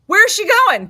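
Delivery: high, loud panicked question; retold panic. Mom to son as elevator doors close, panicked yell. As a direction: blurt it out in mock alarm.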